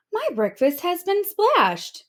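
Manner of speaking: high, indignant mother octopus voice; mother octopus, put out and huffing; a fussy mother octopus complains; huffy mother octopus. Acted.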